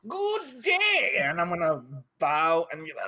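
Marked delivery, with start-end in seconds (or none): 0.1-1.2 much higher, shouted formal greeting; grand greeting shout. Retold or imitated. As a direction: bellow a pompous hello. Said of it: "I'm gonna be like".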